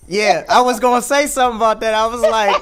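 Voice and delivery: high, loud, laughing praise; excited laughing. Real, not acted. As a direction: cheer her on through laughter. Delighted praise for the lyric.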